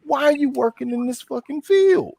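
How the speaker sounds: high-pitched acted confrontation; worked-up, high-voiced scolding; indignant telling-off. Rehearsed telling-off of camp co-director.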